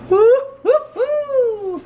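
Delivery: high, drawn-out cheering whoop; excited whoop. Real, not acted. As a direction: whoop with glee, long and high. Cheering as she flips the bag.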